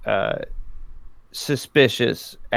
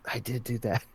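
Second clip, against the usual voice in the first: hushed aside, much softer; whispered confession; 12 dB quieter than usual. Acted. Client leans over to own lawyer, whispered confession. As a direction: confide it under your breath. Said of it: "lean over to my lawyer and whisper".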